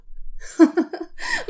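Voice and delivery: high, light laugh; short laugh. Acted. Amused heroine to Ahmed, short high laugh. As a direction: laugh off the question.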